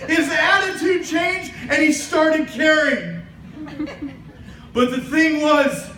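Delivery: ordinary delivery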